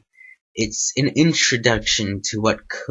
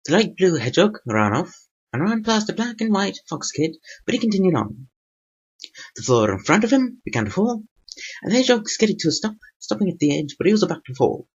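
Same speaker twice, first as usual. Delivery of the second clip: higher-pitched storytelling narration; narrator voice; 8 semitones higher than usual. A narrator's reading. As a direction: read the story a few notes up from your own voice. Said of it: "reading this in a different voice than my normal voice".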